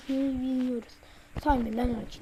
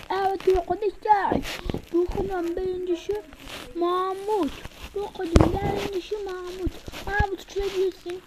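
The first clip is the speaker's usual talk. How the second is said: higher ceremonial winner announcement; announcer voice; 5 semitones higher than usual. Acted. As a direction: present the winner proudly.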